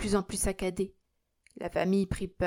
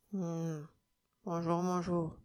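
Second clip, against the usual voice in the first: gruff grumble; 2 semitones lower, 7 dB quieter, slower than usual. A narrator's reading. Sick old man greets the priest, gruffly grumbling. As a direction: grumble a grudging greeting under your breath. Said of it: "grommela le vieillard d'un ton bourru".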